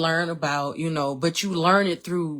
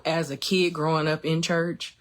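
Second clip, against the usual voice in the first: ordinary talk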